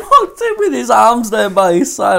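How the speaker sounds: high and loud, laughing as you talk; real laughter. Real, not acted. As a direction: tell it while cracking up.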